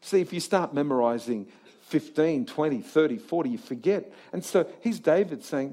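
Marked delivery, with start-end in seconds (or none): none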